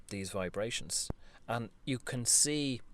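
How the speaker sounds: ordinary talk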